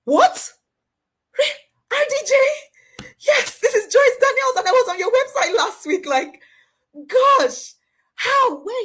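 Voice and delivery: very high, loud, breaking squeal of shock; stunned disbelief. Retold or imitated. She answers her hero's unexpected call, a high shocked squeal.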